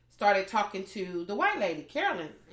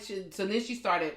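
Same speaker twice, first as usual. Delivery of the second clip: ordinary delivery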